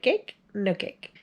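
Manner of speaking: mock baffled barbecue man; puzzled man. Remembering the man at the grill, squeaky then flat. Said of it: "that man, like".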